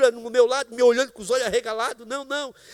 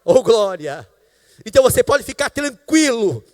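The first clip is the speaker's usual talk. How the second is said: louder and louder, ending in a sung shout; preaching at full force; 4 semitones higher, 10 dB louder, slower than usual. Acted. Preacher assuring the congregation, rising to a loud sung shout. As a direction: build the preaching up until it breaks into song.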